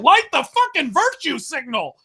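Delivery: high, exasperated mock rallying cry; mock outrage. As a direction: raise the pitch in sarcastic outrage. Sarcastic rant climax.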